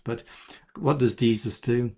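ordinary delivery

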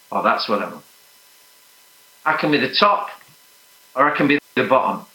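usual speaking voice

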